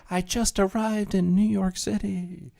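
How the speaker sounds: high, slow breathless tourist voice; imitating excited tourist. Streamer mimics woman arriving in Times Square, high and gushing. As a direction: gush it like a starstruck visitor. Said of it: "She's like".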